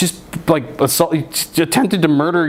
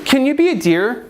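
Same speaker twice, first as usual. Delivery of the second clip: mock sweet voice; 10 semitones higher than usual. Sweet creepy request.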